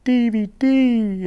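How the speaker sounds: put-on high, drawn-out child's wail; loud mock whine of an upset little girl; imitated crying child. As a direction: wail it slowly like a little girl losing a toy. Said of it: "And she was crying".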